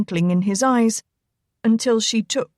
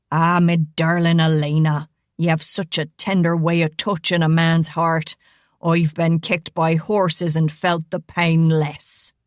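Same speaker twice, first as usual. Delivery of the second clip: thick Irish brogue, lower and mock-wounded; 3 semitones lower than usual. Rigo mocking his own heartbreak to Elena, thick Irish brogue. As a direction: lay the Irish brogue on thick, playing hurt. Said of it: "mimicked in his thick brogue".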